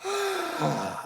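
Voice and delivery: deep breath. Shown as a demonstration. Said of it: "Take a deep breath".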